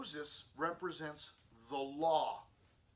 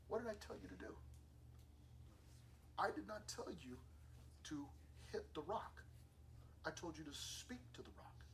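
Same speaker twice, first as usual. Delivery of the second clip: quiet reproof; 10 dB quieter than usual. Retold or imitated. God quietly reproves Moses.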